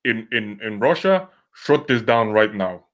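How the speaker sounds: hammy fake Russian accent; bad Russian accent, barking orders. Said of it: "I can't do a Russian accent".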